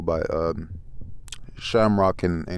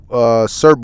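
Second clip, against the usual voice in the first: ordinary delivery, nothing marked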